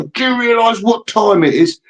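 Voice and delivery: high-pitched mock indignation; indignant callee. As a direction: scold a late-night caller. Woken callee scolds prank caller.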